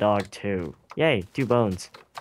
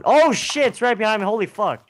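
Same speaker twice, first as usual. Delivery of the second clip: startled high panic; shrieking in sudden fright; being chased; 10 semitones higher, 5 dB louder than usual. Real, not acted. Enderman appears right behind him.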